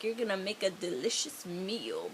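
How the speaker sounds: low, soft imitation of a creepy lizard; lightly voiced low, creepy singsong menace. Lizard sings to his prey, imitated low creepy voice. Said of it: "he's got a really low, creepy voice".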